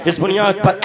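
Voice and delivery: normal talking voice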